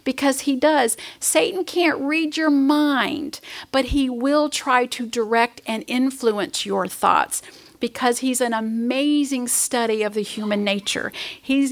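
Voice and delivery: normal talking voice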